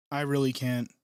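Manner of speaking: ordinary talk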